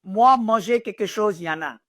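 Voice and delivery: imitating dad's simple broken French; father's broken French. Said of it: "And my father French".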